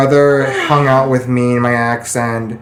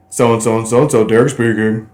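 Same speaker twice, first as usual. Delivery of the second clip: work phone greeting. Retold or imitated. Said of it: "How he answers the phone at work?".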